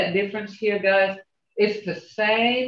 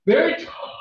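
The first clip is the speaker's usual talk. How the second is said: mock quote; 10 semitones higher, 3 dB louder than usual. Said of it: "She said".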